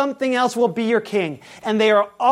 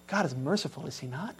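much softer, almost breathed question; hushed; 5 semitones lower, 12 dB quieter, faster than usual. Real, not acted. Preacher quietly asking the congregation about God's mercy. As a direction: draw them in with a near whisper. Preacher drops to near whisper.

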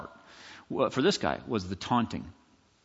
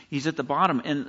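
usual speaking voice

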